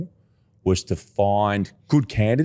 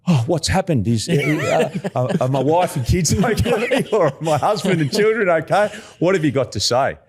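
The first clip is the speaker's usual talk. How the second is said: high-pitched alarm, half laughing; hammed-up frantic worry, firing off questions; mock panic; 9 semitones higher than usual. Client fearing bad news about family from a recruiter's call, mock panicked.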